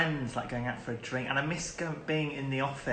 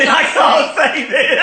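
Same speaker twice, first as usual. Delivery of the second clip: high, loud, slow laughing words; real cracking up mid-sentence; helpless laughter; 17 dB louder, slower than usual. Real, not acted. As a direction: squeal it through uncontrollable laughter. Too amused to get answer out.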